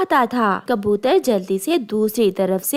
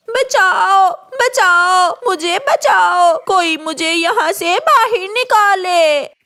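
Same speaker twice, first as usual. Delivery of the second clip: higher, louder cries for help; shouting for help; 7 semitones higher, 4 dB louder, slower than usual. Acted. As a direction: scream for help from inside the hollow tree. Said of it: "गोरी कौवी बहुत शोर मचाती है". (ज़ोर से मदद के लिए चिल्लाना)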